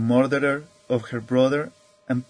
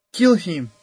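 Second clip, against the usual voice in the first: higher, louder mild outburst; narrated demand, pitched up and falling; hysterical cry; 5 semitones higher, 6 dB louder than usual. A narrator's reading. Old lady repeats her demand for death, hysterical cry.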